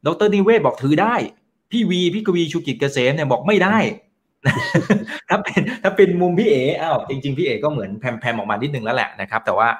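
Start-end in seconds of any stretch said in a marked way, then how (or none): 4.4-5.2 high burst of laughter; amused laugh. Real, not acted. Host laughing at gurus' opposite verdicts, amused. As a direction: laugh at the clashing answers.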